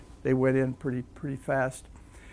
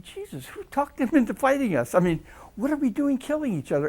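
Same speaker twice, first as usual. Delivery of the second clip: shocked disbelief; 11 semitones higher than usual. Retold or imitated. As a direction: blurt it out in shock. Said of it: "and I say".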